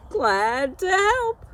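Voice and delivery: high, loud busybody goose voice, pushing her cure; a proud goose lecturing a sick horse, high and loud; bossy know-it-all goose. Acted. Petunia lecturing Straw about his teeth, in a high bossy goose voice.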